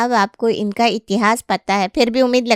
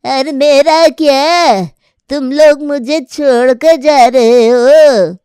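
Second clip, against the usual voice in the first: put-on old mother calling out; 5 semitones higher, 6 dB louder, slower than usual. Grandmother calls from outside.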